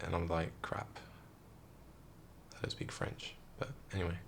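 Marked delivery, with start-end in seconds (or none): 0.6-3.4 whispered panic to himself; under the breath, like a private oh-no thought; quiet whispered dismay; panicked whisper. Retold or imitated. Panicked inner thought at border.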